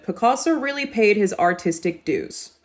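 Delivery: General American read with a hard R in artistic and a plain doo in dues; American accent demo. Shown as a demonstration. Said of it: "the way the Americans would say this".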